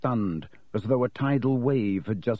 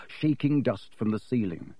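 plain reading voice

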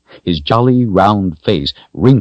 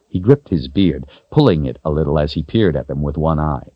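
ordinary delivery, nothing marked

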